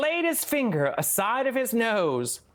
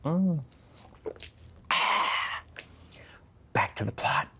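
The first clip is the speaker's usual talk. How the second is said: hushed whisper, much lower; 6 semitones lower, 3 dB quieter than usual. Real, not acted. Whispering a note to herself mid-story. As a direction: whisper it to yourself.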